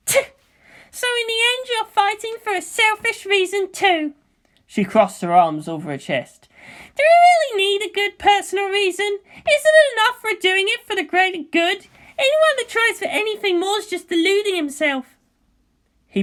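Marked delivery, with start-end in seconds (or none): 0.1-4.1 put-on high, scornful girl; dismissive teen with a click of the tongue; Asuka's voice. Asuka scoffing at Shinji's reason, high and scornful. 7.0-15.2 put-on high, loud, scornful girl; fiery teen pilot snapping; Asuka's voice. Asuka scoffing at Shinji's thanks, high and loud.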